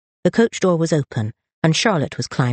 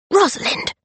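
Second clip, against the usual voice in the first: sharp high hissed call of her name. Acted. Charlotte caught sneaking out, hissing at her sister. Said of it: "Charlotte hissed".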